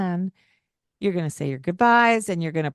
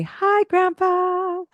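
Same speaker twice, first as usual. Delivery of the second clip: slow, much higher bright greeting to grandpa; high sing-song hello; 14 semitones higher, 4 dB louder, much slower than usual. Shown as a demonstration. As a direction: model a cheery hello for the kids, slow and high. Modeling greeting for a child.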